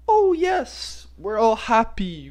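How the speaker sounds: high, drawn-out fake happiness; mock cheerful. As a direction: gush in a high, put-on voice. Mocking fake online happiness.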